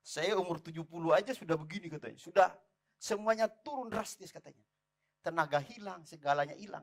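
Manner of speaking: normal talking voice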